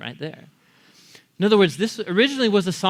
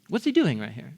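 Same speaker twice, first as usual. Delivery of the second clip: normal talking voice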